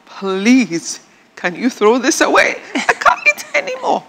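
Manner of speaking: hammed-up begging through laughter; mock pleading. Daughter-in-law silently begs to be spared the soup, comic pleading. Voicing her silent plea over food.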